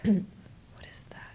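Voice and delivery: slow, hushed, mock bewilderment; baffled whisper. Listeners who don't understand her English "pineapple", baffled whisper. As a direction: whisper it slowly, utterly lost.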